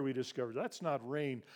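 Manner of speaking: ordinary talk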